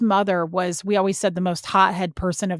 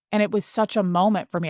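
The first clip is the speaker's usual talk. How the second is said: normal talking voice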